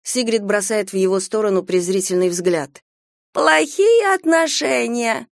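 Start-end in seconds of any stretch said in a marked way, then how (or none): 3.3-5.3 high, slow, squeaky mimicry; squeaky mocking voice. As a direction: parrot it back in a squeal. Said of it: "передразнивает она нарочито писклявым голосом".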